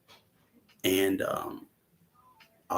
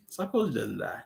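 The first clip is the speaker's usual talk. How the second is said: softer, laughing repeat; 7 dB quieter than usual. Real, not acted. Repeating the fact while laughing softly. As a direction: chuckle it out.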